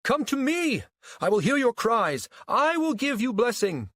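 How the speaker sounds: loud, high-pitched proclamation; commanding messianic cry. A narrator's reading. False Christ calling the crowd to him, commanding cry. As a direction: summon the crowd like a self-styled savior. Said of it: "The tone in his voice and the steely glare".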